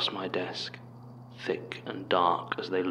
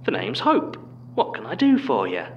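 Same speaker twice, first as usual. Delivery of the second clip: very high, loud cartoon Cockney accent; chirpy mock Cockney greeting; cartoonish Cockney; 11 semitones higher, 5 dB louder than usual. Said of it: "in a similar voice replied".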